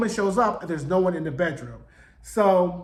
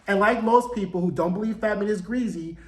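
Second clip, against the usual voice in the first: ordinary talk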